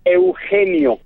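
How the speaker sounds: "Eugenio" as eu-HEH-nio with a Spanish j sound; native Eugenio. Shown as a demonstration. Guest teaching his own name, native Spanish pronunciation. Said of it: "say it one more time".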